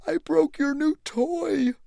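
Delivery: high, slow, dismayed; admitting it in shock; dismayed elephant. Acted. Elephant shocked at broken toy.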